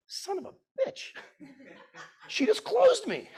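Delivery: high, stunned mock outrage, slow at the end; disbelieving curse. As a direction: realize you've been played, voice jumping high. Realizing wife out-negotiated him.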